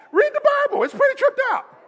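very high falsetto aside; high-pitched comic defence; comic squeak. Acted. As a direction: pop up into a squeaky voice. Comic aside inside Elijah's taunting.

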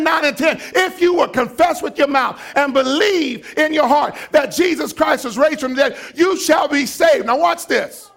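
loud, high shouting sermon; full-force preaching. Acted. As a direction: preach it hard to the congregation.

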